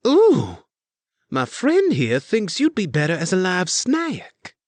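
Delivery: high, loud playful taunt; gleeful, childlike. A narrator's reading. Elise taunts the spy master, gleeful childlike. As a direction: play the delighted child to scare him. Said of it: "in a gleeful, childlike way".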